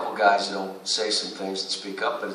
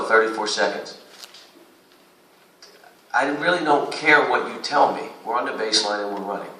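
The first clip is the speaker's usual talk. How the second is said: usual speaking voice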